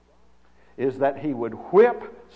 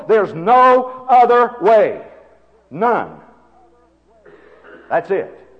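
loud, high, incredulous challenge; full-force preaching; 10 semitones higher, 9 dB louder than usual. Real, not acted. Preacher challenges that there's no other way, full-force preaching.

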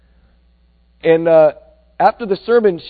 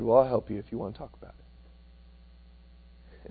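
gentle offer; 7 semitones lower, 10 dB quieter, slower than usual. Retold or imitated. Old man offers help.

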